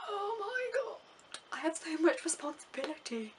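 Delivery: high, wailing cry of panic at the responsibility; mock panic. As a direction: play it like a nervous flyer overwhelmed by duty. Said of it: "I was, like, thinking".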